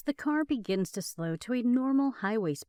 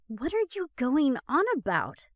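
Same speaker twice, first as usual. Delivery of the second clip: higher, louder, impatient question; 6 semitones higher, 5 dB louder than usual. Acted. Girlfriend cutting off boyfriend's complaint, impatient. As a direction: brush him off impatiently. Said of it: "Becca asks impatiently".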